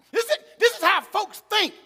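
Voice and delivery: normal talking voice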